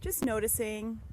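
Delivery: unhurried meditation voice with long pauses; guided meditation. Real, not acted. Guide leading listeners through a body scan, calm meditative voice. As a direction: ease them through it, phrase by phrase.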